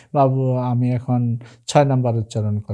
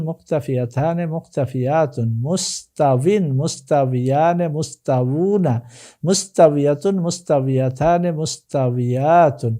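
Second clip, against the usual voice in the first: rhythmic sing-song run through Arabic forms; lifted opening on each set, dropping to a drawn-out final form; drill recitation; 4 semitones higher, 3 dB louder, slower than usual. Shown as a demonstration. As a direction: chant the conjugation table, leaning on each long vowel. Chants Arabic word forms as drill.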